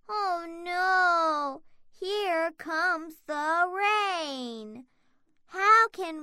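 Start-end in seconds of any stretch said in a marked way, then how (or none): none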